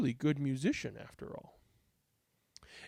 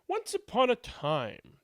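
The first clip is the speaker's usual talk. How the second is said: storybook opening; 5 semitones higher than usual. Shown as a demonstration. Storyteller to kids, the classic fairy-tale opening. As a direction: open the tale like a bedtime story. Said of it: "better to start a story like this with".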